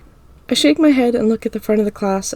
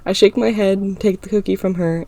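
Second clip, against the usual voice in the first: usual speaking voice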